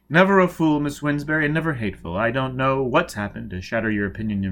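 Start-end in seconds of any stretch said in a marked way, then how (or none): none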